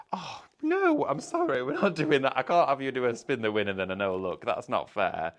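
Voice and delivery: high, loud shocked protest; dismayed outcry. Real, not acted. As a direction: cry out that it's unfair. Disallowing a bad spin result.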